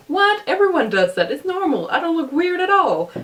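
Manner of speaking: high-pitched, incredulous protest; mock your younger self, voice jumping high; dismissive past self. Creator imitating her past self brushing off criticism, high defensive protest. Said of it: "back then I was like".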